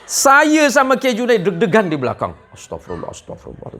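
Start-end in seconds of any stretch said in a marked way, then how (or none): 2.5-3.7 breathless whispered astagfirullah; hushed, nervous prayer. Retold or imitated. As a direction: murmur it under your breath in fear.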